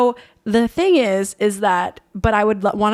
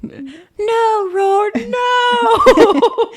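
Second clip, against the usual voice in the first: high, loud mock wail; hammed-up anguished cry, half laughing; Harry Potter impression; 8 dB louder, much slower than usual. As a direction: wail it out like a melodramatic film hero. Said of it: "I need to watch Harry Potter".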